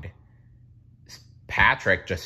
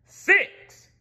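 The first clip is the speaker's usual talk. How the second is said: very high loud roar; 11 dB louder than usual. A narrator's reading. King outbidding Patrick, loud roar. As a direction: roar the number. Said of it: "roared the king".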